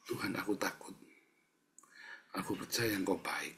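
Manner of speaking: soft, subdued practice prayer; private mirror prayer. Shown as a demonstration. Preacher models morning practice at the mirror, quiet prayer. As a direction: pray quietly to your reflection. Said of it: "Kamu latihan di depan kaca".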